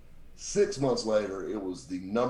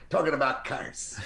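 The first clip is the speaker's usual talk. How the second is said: higher-pitched put-on executive voice; Steve Sinacropi; 5 semitones higher than usual. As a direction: impersonate the radio executive's pitch. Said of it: "do it in my Steve Sinacropi voice".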